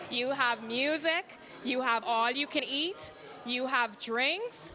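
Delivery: slow, rising Bahamian dialect lines. Real, not acted. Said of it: "use my Bahamian dialect".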